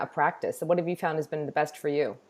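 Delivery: usual speaking voice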